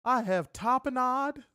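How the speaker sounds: high, slow mocking whine listing spices; mocking co-host. Mocking his co-host's spice collection in a high prissy whine. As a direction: mimic him in a high, prissy sing-song. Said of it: "He was like".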